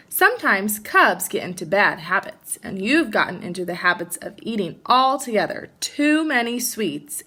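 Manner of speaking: lively storybook read-aloud; animated picture-book narration; reading to children. Acted. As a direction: read it like story time for kids.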